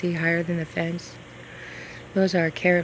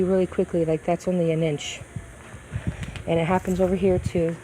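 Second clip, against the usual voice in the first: ordinary delivery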